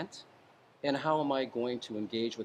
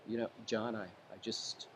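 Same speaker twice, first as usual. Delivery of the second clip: much softer, gentle and calming; 3 semitones lower, 8 dB quieter than usual. Shown as a demonstration. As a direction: lower your voice to settle him down. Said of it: "you might say".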